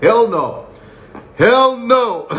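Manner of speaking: high-pitched, drawn-out refusal; mock-horrified high exclamation; emphatic refusal. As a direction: refuse in rising, incredulous cries. Imagined refusal of prison advances.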